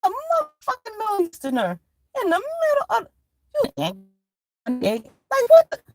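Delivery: high, squealing disbelief with a crying edge; shocked disbelief. Retold or imitated. Comic meeting the store owner, squealing in disbelief. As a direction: squeal it, baffled and outraged. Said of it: "I'm like".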